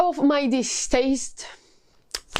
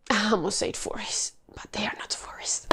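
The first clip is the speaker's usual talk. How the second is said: whispered aside; 3 semitones lower, 7 dB quieter than usual. Real, not acted. Self-correcting aside to viewers.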